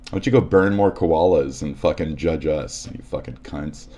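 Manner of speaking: usual speaking voice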